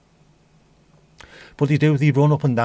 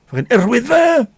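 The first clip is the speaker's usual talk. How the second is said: high, loud strangled Welsh outburst; 11 semitones higher, 7 dB louder than usual. Retold or imitated. Scouse-sounding North Walian suddenly saying something Welsh, strangled. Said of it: "And it's proper strangled".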